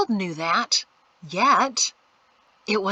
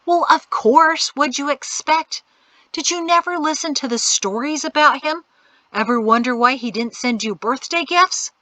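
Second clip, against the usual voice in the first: scornful; 6 semitones higher, 6 dB louder than usual. A narrator's reading. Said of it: "Anoki rolled his eyes".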